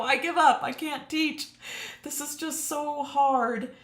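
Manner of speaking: high-pitched exasperated wail, acted out; mock giving up. Streamer recalling her early teaching struggles, mock despair. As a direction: imitate your old self throwing it all down. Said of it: "wanting to throw everything down and just go".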